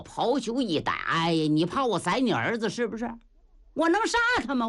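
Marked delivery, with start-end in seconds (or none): 3.7-4.7 high, mocking retort; scoffing Niu Gao. Niu Gao scoffing at the marshal's fear, high and mocking. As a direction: toss it off high and dismissive.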